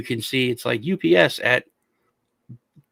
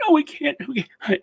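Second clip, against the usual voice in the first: high-pitched imitation of a distraught woman; mock near tears; 13 semitones higher than usual. Old woman to Microsoft support, imitated as near tears. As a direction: protest up high as the tearful wife. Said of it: "like, started crying".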